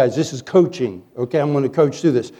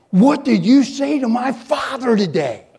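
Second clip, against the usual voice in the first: higher, slower, accusing son-in-law voice; mock angry son-in-law; 6 semitones higher than usual. Son-in-law confronting the preacher on phone, accusing. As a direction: demand it like an upset son.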